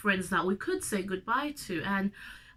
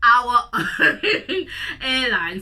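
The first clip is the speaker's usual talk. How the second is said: laughing; 4 semitones higher, 9 dB louder, much slower than usual. Real, not acted. Proud of Air Zimbabwe.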